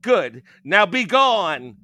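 loud, slow witch command. A narrator's reading. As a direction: banish her with a roar. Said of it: "says Baba".

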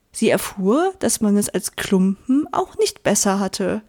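plain reading voice

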